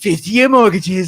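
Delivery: slow, drawn-out politician impression; put-on presidential boasting; Donald Trump. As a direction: imitate a rambling president pitching a plan. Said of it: "Trump went on there".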